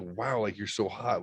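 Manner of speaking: soft, hushed admiring wow. Shown as a demonstration. Explaining the wow to a partner, admiring. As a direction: say it quietly, impressed. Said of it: "be specific with the wow".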